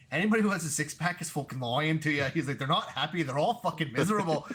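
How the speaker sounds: loud, high mock Irish brogue; Irish accent impression. Imitating a fighter defending his belly, in loud mock Irish accent. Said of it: "hard Irish accent is just like".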